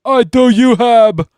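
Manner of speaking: high, loud, slow furious roar. Acted. Neville roaring at Bellatrix over his parents. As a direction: roar it in rage. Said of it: "roared Neville".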